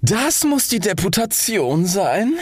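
high, slow, stately rocket voice; would-be dignified. A narrator's reading. Rocket awaiting a deputation, high and pompously slow. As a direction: stretch it grandly, pitched high, putting on dignity. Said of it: "sehr würdig dreinzuschauen".

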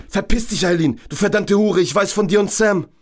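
high, raging insult; furious screaming. Acted. Georg screams abuse at Eileen through the door, high and furious. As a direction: spit the accusation at her.

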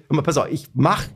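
plain reading voice

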